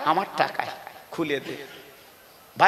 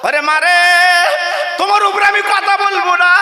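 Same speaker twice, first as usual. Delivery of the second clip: much higher shouted vow; preaching shout; 9 semitones higher, 4 dB louder than usual. Acted. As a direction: cry it out at full force. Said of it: "ছেলে বলে".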